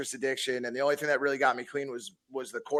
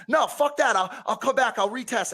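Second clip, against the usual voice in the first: much higher, louder panicked denial; defiant brush-off; 9 semitones higher, 5 dB louder than usual. Retold or imitated. To his probation officer, a high, loud, panicky brush-off. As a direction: brush it off fast, voice jumping high.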